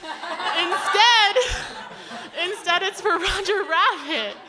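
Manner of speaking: mock outrage over a Roger Rabbit room; incredulous rant. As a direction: scoff at the wasted money, laughing. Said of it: "And I was like".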